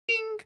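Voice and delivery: high-pitched ding sound effect; mock ding. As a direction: sing out a tiny high ding. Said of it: "does not make that sound".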